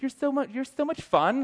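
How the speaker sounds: very high, slow gushing; mock girlish admiration, drawn out; imitated admiring girl.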